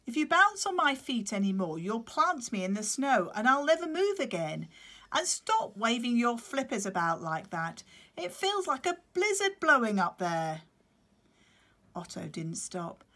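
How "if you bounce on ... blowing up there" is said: higher, louder grumbling adult penguin; grumpy penguin. Acted. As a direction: scold the bouncing chick. Claudius voiced by reader.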